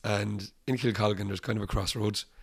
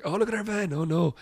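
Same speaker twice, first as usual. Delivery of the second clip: mock dismay; 9 semitones higher than usual. Other driver sees damaged van.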